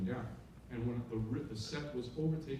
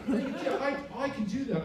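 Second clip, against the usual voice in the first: imitating Tom insisting; 11 semitones higher, 5 dB louder than usual.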